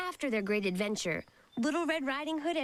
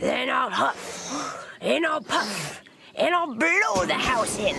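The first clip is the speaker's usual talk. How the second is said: slow, drawn-out threat; big bad wolf menacing the pig; wolf threatening to blow the house down; 6 dB louder, slower than usual.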